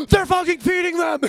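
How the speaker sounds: gasp, then a high loud thrilled shout; excited shout. Retold or imitated. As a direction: yell it to the whole aquarium.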